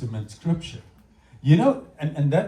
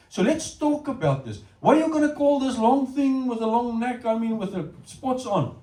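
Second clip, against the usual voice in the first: lightly voiced God, raised pitch; playful questioning, a bit higher; God quizzing Adam; 7 semitones higher than usual. God asking Adam to name the giraffe, playful.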